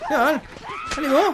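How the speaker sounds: high, loud challenge to enemies; loud high-pitched battle taunt; shouted taunt. Pretend. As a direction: shout it out, daring them on. Taunting enemies during the fight.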